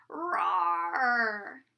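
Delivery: long, low growled roar; storybook dragon roaring in battle. A narrator's reading. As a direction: draw out a dragon's roar, low and loud-ish. Narrator voices the battle roar.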